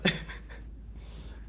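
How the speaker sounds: a short wheezy laugh under his breath; breathless laugh. Real, not acted. Vlogger laughing at being winded. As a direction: laugh it off while panting.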